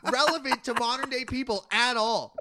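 high, loud frustrated outburst; exasperated rant. Real, not acted. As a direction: vent at the pointless list. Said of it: "how mad you're getting".

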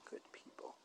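whispered. Real, not acted. Hushed echo of praise.

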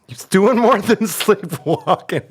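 high, drawn-out words through laughter; laughing punchline. Retold or imitated. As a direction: crack up while quoting the wife.

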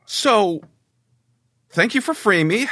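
high, light creature voice, grateful; freed creature, relieved. Acted. Freed creature thanks the party in a high, grateful voice. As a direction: thank the rescuers in a high lilting voice.

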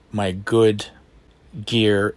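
usual speaking voice

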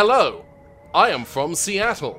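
hammed-up American, announced loudly; attempted American accent. Shown as a demonstration. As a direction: put on an exaggerated American accent. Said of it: "your attempt to sound American from Seattle".

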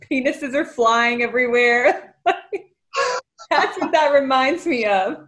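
laughing through the words; amused, pitch rising with laughter. Real, not acted. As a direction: crack up while describing it. Laughing at absurd film scene.

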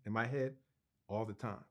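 ordinary talk

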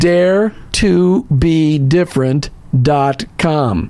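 slow, low, every syllable of Dare to Be Different dot com spelled out; over enunciating. Shown as a demonstration. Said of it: "Let me say it slowly".

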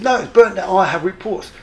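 high, loud insistence; indignant officer. Retold or imitated. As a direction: shut him down with authority. Commander insists on the fire.

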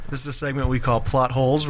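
usual speaking voice

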